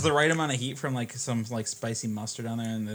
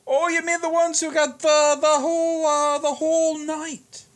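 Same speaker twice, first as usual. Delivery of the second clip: bartender voice; 6 dB louder than usual. Acted.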